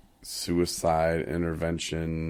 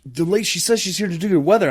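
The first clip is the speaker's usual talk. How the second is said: higher, fast put-on voice; coworker explaining; 12 semitones higher, 5 dB louder, faster than usual. Coworker explaining the weather lady's visit, fast and high. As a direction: rattle it off like a flustered coworker.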